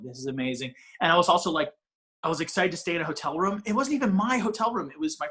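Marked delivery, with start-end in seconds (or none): 0.0-0.7 soft, low, starstruck murmur; hushed awe. Retold or imitated. Broke young worker to host, starstruck by wrestlers. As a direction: say it under your breath, awestruck. Awed inner thought retold.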